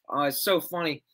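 normal talking voice

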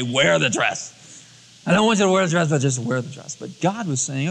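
ordinary talk

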